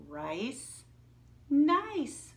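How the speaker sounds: teacher showing a rhyme; rhyme stressed. Shown as a demonstration. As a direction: play up the rhyme, with nice popping up. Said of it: "Those two words rhyme".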